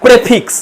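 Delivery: "প্রেফিক্স" with short e in the first syllable; Indian English pronunciation. Shown as a demonstration. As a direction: say "প্রেফিক্স" the Indian way, starting "pre". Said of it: "আমরা উচ্চারণ করি".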